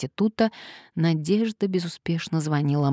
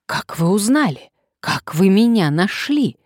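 higher, louder alarmed questions; mildly panicked, as if found out; trembling voice; 4 semitones higher, 6 dB louder than usual. A narrator's reading. Bald man to the woman who found him, alarmed. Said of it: "забормотал Лысый дрожащим голосом".

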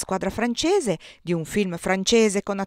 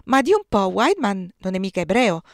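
higher, louder horrified mother; imitating mother; 5 semitones higher, 6 dB louder than usual. Son mimics his mother's horrified question to a friend, higher and louder. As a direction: ham up her shocked gossip, higher and louder. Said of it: "Mia madre ha ritrovato esattamente lo stesso tono".

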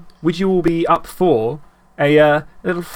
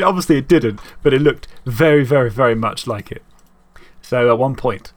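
normal talking voice

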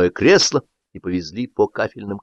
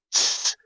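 hushing; 7 dB quieter than usual. Acted. Hushing before surprise.

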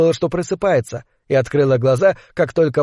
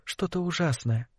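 quiet; 2 semitones higher, 12 dB quieter than usual. A narrator's reading. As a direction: keep it soft and quiet. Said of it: "сказала Лилия негромко".

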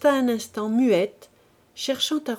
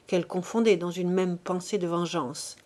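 ordinary delivery

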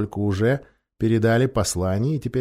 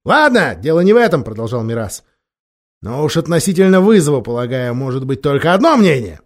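impatient king; 3 semitones higher, 7 dB louder than usual. A narrator's reading. Miraz dismissive, louder.

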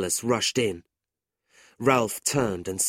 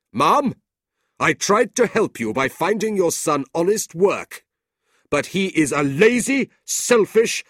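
threatening uncle; 8 semitones higher, 8 dB louder than usual. A narrator's reading. Ralph threatens Mrs Nickleby, loud and cold. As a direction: threaten her coldly.

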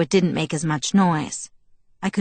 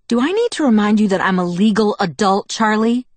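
raised, pointed rhetorical question; heated challenge; 4 semitones higher, 4 dB louder than usual. A narrator's reading. Daughter reminds father she is an adult, heated. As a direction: assert yourself, louder and higher. Daughter argues back in quarrel.

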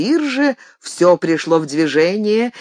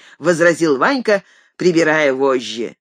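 plain reading voice

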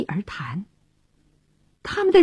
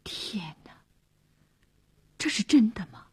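hushed, much softer disbelief; stunned, barely voiced question; bewildered dismay; 9 dB quieter than usual. A narrator's reading. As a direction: murmur it in shock. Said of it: "克里斯惶惑了".

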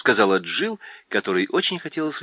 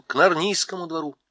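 usual speaking voice

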